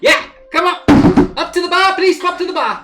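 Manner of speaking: high, loud eager squirrel; little squirrel. Acted. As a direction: shout customers over in a tiny high voice. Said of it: "little squirrel pop up on top from behind the bar and goes".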